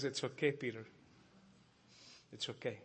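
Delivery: soft and hushed, like consoling a weeping friend; gentle comfort. Acted. Savior comforting guilty Peter.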